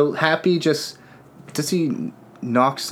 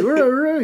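very high wordless mumble, imitating a full mouth; mock mouth-full garble; 14 semitones higher than usual. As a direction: garble it higher, rising like a stuffed-mouth question. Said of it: "It's hard to talk with my mouth full".

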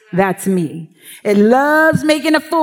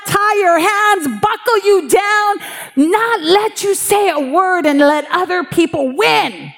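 fiery preaching; 6 semitones higher than usual. Acted. Pastor urging the congregation about God's ways, preaching hard. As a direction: drive it home from the pulpit with rising fervor. Preaching rises to full force.

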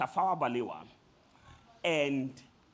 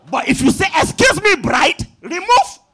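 mock shout; 9 semitones higher, 15 dB louder than usual. Mock call to the bride.

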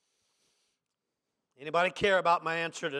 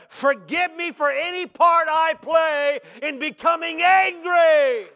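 loud, high, pounding sermon delivery with a raised voice; preaching at full force; 7 semitones higher, 10 dB louder than usual. Acted. Preacher crying out a prayer against anger to the congregation, full force. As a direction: preach it hard, building to a shout.

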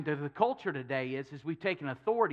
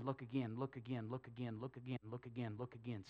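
softer and softer, lower, fading away; fading repetition; 6 semitones lower, 14 dB quieter than usual. Acted. Elijah telling his servant to keep looking, fading to near silence. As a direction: let each look again sink quieter.

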